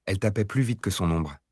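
ordinary delivery

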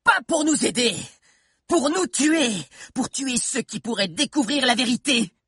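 very high furious witch voice; enraged witch. Acted. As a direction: spit the accusation in rage. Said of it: "Comme enragée".

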